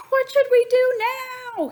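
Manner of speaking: high, loud panicked holler; running round the egg in panic. A narrator's reading. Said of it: "he hollered".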